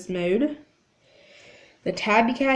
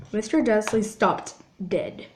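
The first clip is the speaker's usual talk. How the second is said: ordinary delivery, nothing marked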